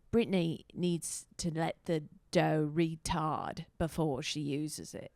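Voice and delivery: slow, put-on British accent. Retelling someone on British Bake Off, in a British accent. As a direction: imitate a British baking-show presenter, slowly. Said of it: "How do you do a British accent?".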